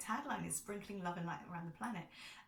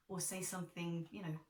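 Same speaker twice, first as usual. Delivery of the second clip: normal talking voice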